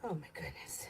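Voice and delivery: whispered, barely voiced, to herself; a soft winded whisper after rushing back; breathless whisper. Real, not acted. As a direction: breathe it out under your breath.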